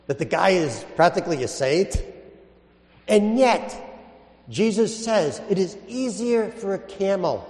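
ordinary talk, nothing marked

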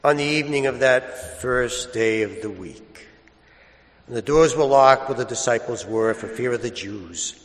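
solemn measured liturgical reading; Gospel reading. Acted. Proclaims the Gospel at Mass.

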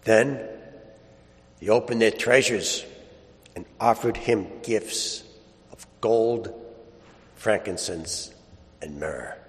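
solemn Gospel reading. Acted. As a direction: read scripture with hushed reverence. Solemn Gospel reading, held style.

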